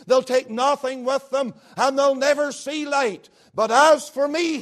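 mounting pulpit fervour; impassioned preaching. Acted. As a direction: swell toward but as for me. Preaching at full force.

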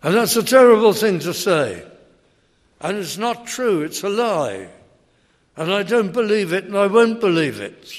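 sceptic's indignant loud rebuttal; indignant objector. Retold or imitated. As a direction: hurl the objection back. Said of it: "And they say".

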